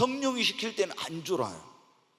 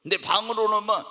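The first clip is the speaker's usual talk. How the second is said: normal talking voice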